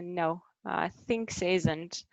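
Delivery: ordinary talk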